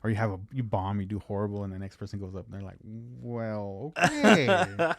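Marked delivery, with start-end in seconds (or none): 2.8-4.9 a drawn-out, amused "well, okay"; mock dismissive comic. Next comic mocks the bombed set in a loud, amused drawl. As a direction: brush off the last act with a laugh.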